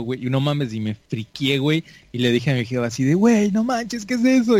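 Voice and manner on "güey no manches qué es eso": alarmed. Retold or imitated. Retelling his fright at the flames.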